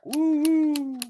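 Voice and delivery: high drawn-out "woo-woo"; long, high celebratory hoot; playful whoop. Real, not acted. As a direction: whoop it up after a cheeky boast. Whooping after own boast.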